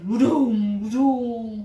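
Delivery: loud booming thunder imitation; thunder sound. Storyteller booming the thunder strike for kids.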